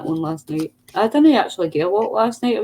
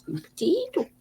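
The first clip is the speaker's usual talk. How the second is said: high, softer mock-Irish squeak; imitated Irish accent; 13 semitones higher, 6 dB quieter, slower than usual. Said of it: "It's [name]'s Irish".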